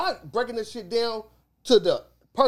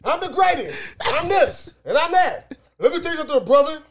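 higher promo boast, GREATEST, THIS and THAT punched; wrestler's emphasis; 4 semitones higher, 4 dB louder than usual. Shown as a demonstration. Showing co-hosts promo emphasis, key words punched high. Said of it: "emphasis on what they was talking about".

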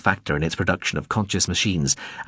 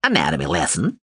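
drone voice; 2 semitones lower than usual. A narrator's reading. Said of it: "it said".

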